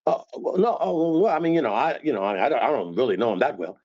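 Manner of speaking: stammering, rushing denial; flustered backpedal. Shown as a demonstration. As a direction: squirm out of admitting it fast. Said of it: "You hear? You see that?".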